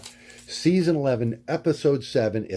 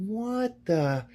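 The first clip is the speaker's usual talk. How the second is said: surprised; 10 semitones higher than usual. Retold or imitated. Reacting to riot on screen.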